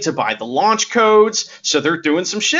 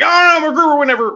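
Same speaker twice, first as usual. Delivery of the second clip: fast, high, louder mock outrage; MacGruber's indignation; 10 semitones higher, 4 dB louder, faster than usual. Imitating MacGruber scolding Vicky, in mock outrage. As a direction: rattle it off in fake indignation. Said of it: "and he's like".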